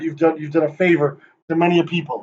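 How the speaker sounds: ordinary talk, nothing marked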